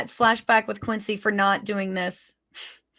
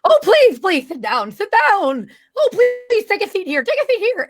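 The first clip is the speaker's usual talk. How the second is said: high, eager fussing host; Mad Hatter voice; 10 semitones higher, 4 dB louder than usual. Acted. Mad Hatter seating guests at his table, high and eager. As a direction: usher them in with high insistent cries. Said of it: "he gestures and says".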